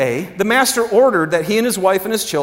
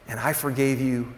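very soft, low, falling to a whisper; hushed; 9 semitones lower, 14 dB quieter than usual. Acted. Preacher voicing what God is saying about forgiven debt, hushed. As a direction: drop almost to nothing and let it sink in. God's contrast of debts, hushed.

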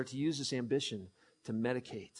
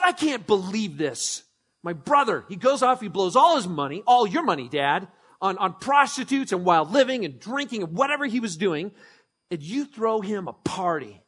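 raised, slowed indignant complaint; indignant rant; 6 semitones higher, 9 dB louder than usual. Acted. Older brother ranting to his father, indignant and raised. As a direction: rant about the unfair party. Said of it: "and he goes".

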